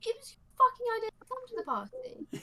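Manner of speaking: high-pitched angry outburst. Acted. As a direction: snap at them furiously. In-character argument.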